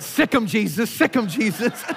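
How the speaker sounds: high, laughing sick 'em; laughing punchline. Retold or imitated. Preacher laughing at his own joke's punchline. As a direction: crack up while delivering the line. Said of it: "And he said".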